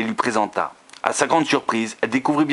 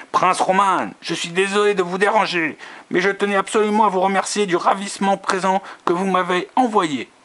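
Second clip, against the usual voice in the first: high, honeyed society lady; velvety voice; 10 semitones higher than usual. A narrator's reading. A rival flatters the prince, high velvety voice. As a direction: purr the thanks up high, all charm. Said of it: "une voix veloutée".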